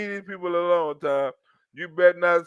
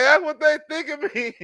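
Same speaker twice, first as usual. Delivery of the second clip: mock hurt victim; 8 semitones higher than usual. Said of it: "He'd be like".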